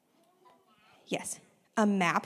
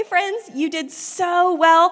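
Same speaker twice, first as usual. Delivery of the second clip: ordinary talk, nothing marked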